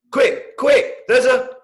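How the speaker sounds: put-on high, loud, breathless little boy; excited child voice. Little brother bursting into the bedroom, high excited squeal.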